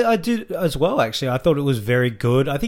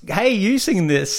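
lightly imitated singer calling out to the crowd, higher; mock call-out, pitched up and slow; 7 semitones higher, slower than usual. Host imitates a singer handing over the song, playful call-out. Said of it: "He did a very [name] twenty eighteen and say".

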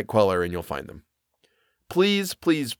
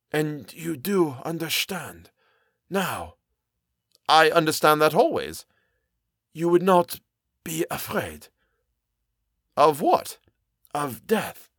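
put-on high-pitched accented character in dialogue; high, deliberate character voice; voiced story character; 5 semitones higher than usual. A narrator's reading. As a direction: speak as an uneasy foreign character, pitched up.

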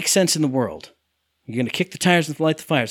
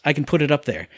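ordinary talk, nothing marked

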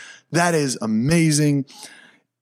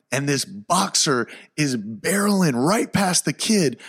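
normal talking voice

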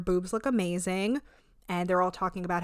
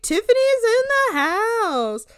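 much higher, louder, slow triumphant announcement; hammed-up entrance; 14 semitones higher, 7 dB louder, slower than usual. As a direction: announce yourself like a diva. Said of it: "she's like".

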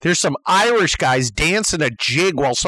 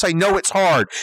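normal talking voice

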